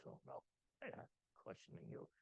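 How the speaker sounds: imitated agent, hushed and quick; meek backing down. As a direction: retreat softly in mock submission. Customs agent backs down, imitated.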